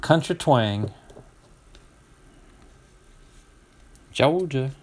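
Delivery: mock Georgia country twang. Host joking with viewers after pronunciation attempts, mock country twang. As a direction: put on a light Southern twang. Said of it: "speaking with a country twang".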